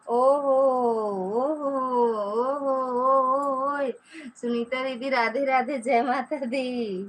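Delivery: loud, drawn-out delighted hail; excited greeting. Real, not acted. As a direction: call out a long, loud welcome. Greeting a newly arrived viewer. (जोश भरा लंबा अभिवादन)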